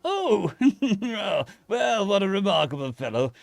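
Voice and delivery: high, loud British toff with a chuckle; delighted posh hamster. Acted. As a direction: marvel like an upper-class gent.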